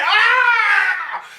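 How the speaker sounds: shrill mock scream; high scream. Boy screaming as mama beats him, high scream. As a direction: scream like hell. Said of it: "And then screaming like hell".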